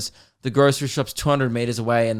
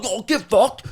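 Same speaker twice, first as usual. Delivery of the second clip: rowdy private school lads; 9 semitones higher, slower than usual. Retold or imitated. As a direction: crow like a boisterous schoolboy landing a hit. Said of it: "he's maybe like".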